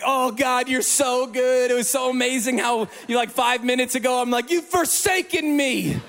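a hint of tearful thanks to God; grateful man thanking God on the drive home, high and overjoyed; weeping gratitude. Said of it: "I was just weeping".

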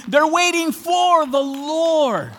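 full-voiced pulpit proclamation; preaching shout. Real, not acted. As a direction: cry it out to the congregation. Preacher building to climax.

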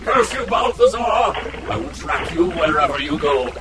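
shouted villain vow. Acted. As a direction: bellow the vow of revenge after him. Villain vows revenge shouting.

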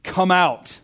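scripture read as a ringing summons; loud cry. A narrator's reading. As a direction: call it out loudly, leaving space before the command. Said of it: "Jesus cried out in a loud voice".

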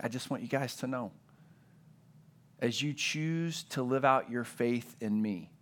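prepare them gently for hard times; tender Jesus. Retold or imitated. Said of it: "and he's saying".

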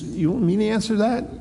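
high scornful taunt at a beaten prisoner; mocking Pilate. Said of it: "Pilate, you know, he says".

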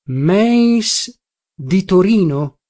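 drawn-out, pondering question; feigned recollection. A narrator's reading. Narrator pretending to recall a Meis from Turin, musing slowly. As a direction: stretch it as if searching your memory. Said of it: "fingendo di cercar nella memoria".

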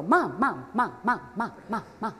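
lightly mimicked pestering child; nagging kid. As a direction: imitate a kid repeating the same call in a steady stream. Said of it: "one of my kids who would go".

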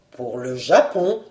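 low, stunned echo with a questioning lift; low-voiced, taken-aback baron; dumbfounded. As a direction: repeat it in bewildered disbelief. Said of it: "répétait le baron ahuri".

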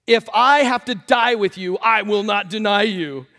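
slow, loud, emphatic vow; vehement. A narrator's reading. Peter swears loyalty to Jesus, vehement. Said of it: "he spoke more vehemently".

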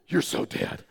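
breathy mock-ominous pronouncement; hushed, dramatic doom; retold verdict. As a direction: imitate a kid declaring dad doomed. Daughter's verdict, acted.

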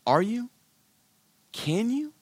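rising, probing questions; challenging doubt. Acted. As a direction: gently call their bluff. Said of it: "I would simply say to you".

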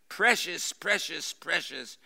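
high loud crooning Precious, fading; Gollum crying out. A narrator's reading. Gollum gloating over the ring, crying out high and loud. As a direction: Gollum shrieks over his prize. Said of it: "Gollum cried".

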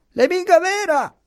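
very high, shrieky denial; yelling from upstairs. A narrator's reading. Servant contradicts her master from upstairs, yelling. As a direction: cry out in a piercing high voice. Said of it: "gridò la Marianna dall'alto".

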